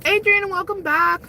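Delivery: talking with a mouth full. Real, not acted. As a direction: speak through a bite of food. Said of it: "I don't mean to talk with my mouth full".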